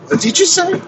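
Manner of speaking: high, startled challenge from the AI; a lightly voiced AI snapping back at her; mock offended AI. The AI bristling at being called out.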